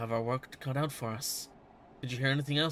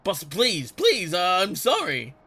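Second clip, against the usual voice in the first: loud, higher desperate begging; pleading apology; 6 semitones higher, 9 dB louder, slower than usual. Acted. Bandit begging his angry boss for mercy, loud frantic plea. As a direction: beg the boss not to punish you.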